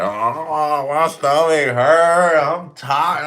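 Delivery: high, loud, drawn-out whine; mock whining. Trainer mimicking everyone complaining in training, mock whining. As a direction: mimic a complainer groaning about aches, dragged out. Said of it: "Everyone's like".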